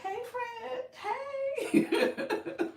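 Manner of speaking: very high, loud singsong hey; mock-gushing greeting with laughter; playful greeting. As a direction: call out a high, giggly hello. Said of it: "I'm just gonna be like".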